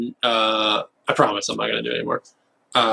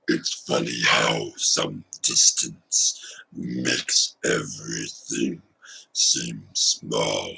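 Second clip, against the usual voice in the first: very low, slow, breathy possessed growl; demon voice; 13 semitones lower, 4 dB quieter, slower than usual. Shown as a demonstration. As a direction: speak like a demon taking over. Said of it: "I can do a demon voice".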